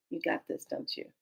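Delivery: low, very soft murmur; hushed, confiding check-in; quiet aside. Retold or imitated. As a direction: murmur it privately up to the heavens.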